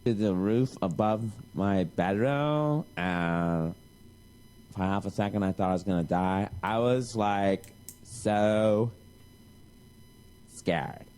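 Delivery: low, slow, breathy melodrama; mock dramatic storyteller. Mocking the sister on the show, low slow dramatic. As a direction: ham up a scary confession.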